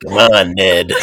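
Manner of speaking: low raspy ghoul voice; Beetlejuice. Pretend. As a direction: growl it like a cackling ghoul. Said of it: "I was definitely going for Beetlejuice".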